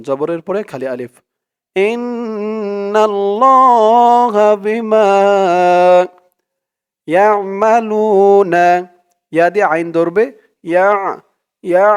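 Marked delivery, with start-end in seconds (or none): 1.8-8.7 slow melodic Quranic recitation. Shown as a demonstration. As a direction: chant the verse with tajweed. Teacher recites Quran verse. (ধীর সুরেলা কুরআন তিলাওয়াত)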